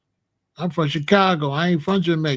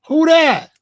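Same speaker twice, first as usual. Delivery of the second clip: startled call; 9 semitones higher, 8 dB louder than usual. Retold or imitated. Buyers startled at the door.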